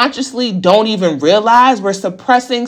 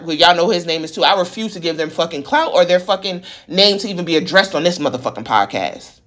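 ordinary delivery

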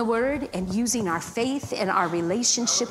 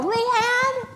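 calling a name; 12 semitones higher than usual. Retold or imitated. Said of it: "I heard her go".

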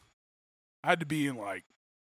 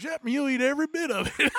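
much higher, laughing through the quote; cook's comeback; 11 semitones higher, 4 dB louder than usual. Retold or imitated. The Waffle House cook threatening drunk frat dudes, laughing. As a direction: deliver the threat while cracking up. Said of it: "and he goes".

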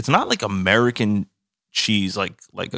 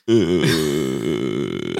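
long, low, drawn-out French "euh"; exaggerated low groaning "uh"; French hesitation "uh"; 7 semitones lower than usual. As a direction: imitate a French filler, droning low. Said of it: "how many times people go".

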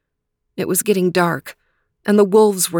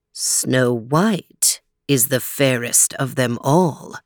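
lower, slower magic mirror repeating; shimmering echoing mirror voice; 6 semitones lower, slower than usual. Acted. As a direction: drag it out, deep and calm. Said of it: "repeated the magic mirror".

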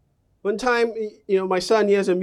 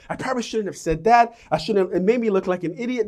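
fast, fretful inner monologue; replaying embarrassment, rushed; anxious self-talk; faster than usual. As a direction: rattle it off in a worry. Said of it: "go over through your head".

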